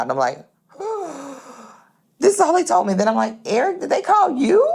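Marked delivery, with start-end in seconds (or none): 0.8-1.3 gasp. Retold or imitated. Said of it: "I'm freaking out". 2.2-4.6 much higher, near-squealing panic; frantic high-pitched calling to her son; freaking out. Retold or imitated. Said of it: "I'm freaking out".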